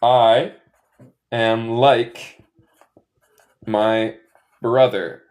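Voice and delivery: I am like my brother with pauses; teacher modelling comparison like; slow, word by word. Shown as a demonstration. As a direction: lay each word down slowly. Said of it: "en este caso no significa".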